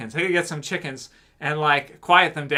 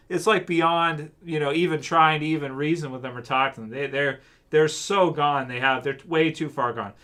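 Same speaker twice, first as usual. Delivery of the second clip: ordinary delivery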